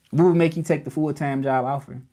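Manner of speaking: ordinary talk, nothing marked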